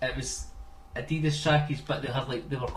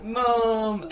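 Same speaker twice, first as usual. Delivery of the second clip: drawn-out, higher cry for mum; calling for mum; 6 semitones higher than usual. Retold or imitated. Retelling a Christmas sketch to friends, mock calling for mum. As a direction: imitate a kid calling out to his mother. Child in sketch calls mother.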